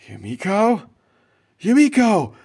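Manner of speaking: rising, high, loud joyful call; joyful disbelief. Acted. Man seeing his ex return, loud joyful call. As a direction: cry out with happy relief. Ex-girlfriend reappears.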